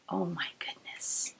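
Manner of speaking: breathy hushed whisper. Acted. Reader awed by the opening page, whispered. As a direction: hush it, secret-like.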